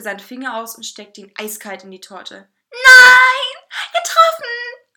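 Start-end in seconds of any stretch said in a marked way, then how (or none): 2.7-4.9 high, very loud shriek; outraged high-pitched scream. A narrator's reading. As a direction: shriek in horror, stretched out. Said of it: "schrie der Kuchen".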